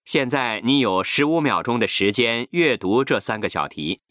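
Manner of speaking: normal talking voice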